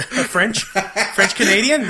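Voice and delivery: slow, drawn-out French Canadian voice; French Canadian mocked by his friend, slow and drawn out. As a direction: stretch out "French Canadian" like a Quebecer. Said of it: "your French Canadian sounds Puerto Rican".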